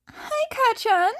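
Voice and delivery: put-on high, eager boyish greeting; a high boyish voice calling out a happy hello; bright young Izuku. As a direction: chirp it up high and cheery. Izuku greets his classmate.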